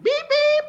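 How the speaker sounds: very high imitated alarm beeps; loud, squeaky electronic beep imitation. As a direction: mimic a door alarm going off. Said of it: "like, an alarm".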